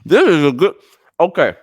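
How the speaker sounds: loud words through a bite; mouth full. Real, not acted. Host praising the sponsor's scone while chewing. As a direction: speak past the food, chewing. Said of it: "enjoying my scone".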